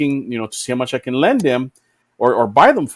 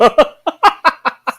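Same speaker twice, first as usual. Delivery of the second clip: loud high laugh breaking out; high burst of laughter; 10 semitones higher, 4 dB louder than usual. Real, not acted. Laughing at a drunk friend's line, cracking up.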